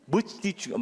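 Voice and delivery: ordinary delivery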